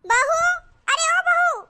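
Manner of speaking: high-pitched call to the bahu. Acted. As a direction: call out high across the house. Calling out to daughter-in-law.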